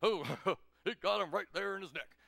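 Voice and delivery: chuckling presidential impression; President Obama. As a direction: marvel with a chuckle as the dignitary. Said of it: "President Obama picks up".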